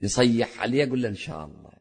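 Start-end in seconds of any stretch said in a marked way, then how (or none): 1.1-1.7 very soft, low, respectful reply; gentle, smiling deference. Acted. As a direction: answer father softly, with a smile in it. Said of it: "تتبسم في وجهه".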